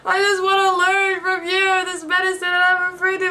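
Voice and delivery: high, whiny hammed-up weeping; mock crying. Pupil begging old doctor to teach him, mock crying. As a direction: wail it like a pleading pupil, pretend tears. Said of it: "he starts crying".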